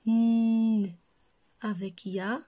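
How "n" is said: "N" as one long, loud hummed nnn; isolated consonant sound. Shown as a demonstration. Teacher gives children the first syllable, consonant "N" held long. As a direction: hold the sound of "N", not the letter name. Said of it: "Alors, la première syllabe".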